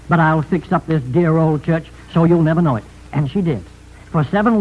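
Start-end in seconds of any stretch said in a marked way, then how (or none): none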